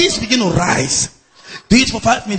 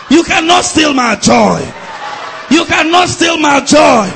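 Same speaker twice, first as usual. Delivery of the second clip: loud, high, building shouted declarations; full-force preaching; 10 semitones higher, 7 dB louder than usual. Real, not acted. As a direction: defy the devil at the top of your voice.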